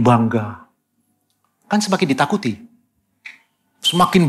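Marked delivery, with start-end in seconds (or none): none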